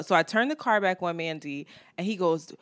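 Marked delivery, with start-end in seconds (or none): none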